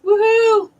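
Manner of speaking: loud, high woohoo; excited cheer. Real, not acted. Cheering for the first pick.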